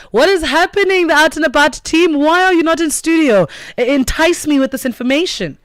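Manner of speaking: high, loud playful questioning; excited teasing. Real, not acted. Host greeting the outdoor team with high, loud excitement. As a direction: tease her with bright excitement.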